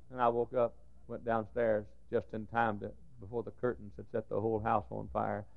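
normal talking voice

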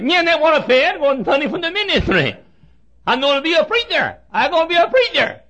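high mock voice, garbled ministry and preacher as preater; hammed-up impediment. Preacher mimicking Joe for the crowd, high mock garble. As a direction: imitate Joe's tongue-tied insistence. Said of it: "can't even talk plainly".

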